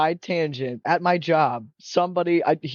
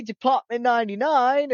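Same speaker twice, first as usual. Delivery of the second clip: high-pitched British announcement; excited British accent; 8 semitones higher than usual. Retold or imitated. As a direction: call it out like an eager British manager. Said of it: "story with the British guy".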